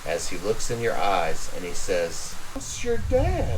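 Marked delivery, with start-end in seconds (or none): 2.6-3.6 high, slow broken confession; tearful reveal. Acted. Clevis to Lucian in the storm, tearful. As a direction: let it drop heavily.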